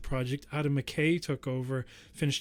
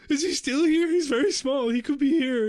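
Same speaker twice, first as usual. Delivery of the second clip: high excited voice; 11 semitones higher, 5 dB louder than usual. Pretend. Mock searching for tiny Ant-Man.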